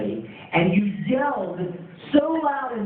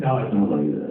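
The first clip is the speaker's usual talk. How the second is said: much lower deep voice; deep voice impression; 12 semitones lower than usual. Colleague correcting fans in his much lower voice. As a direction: rumble it from deep in the chest. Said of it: "and he said".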